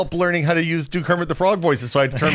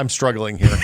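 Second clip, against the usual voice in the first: normal talking voice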